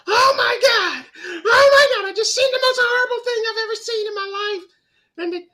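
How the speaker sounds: shrieking high, loud and horrified; mother having a fit. Retold or imitated. Mom back from the drive-in, shrieking high and horrified. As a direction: squeal it like a mom back from a gory movie. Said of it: "having a fit over it".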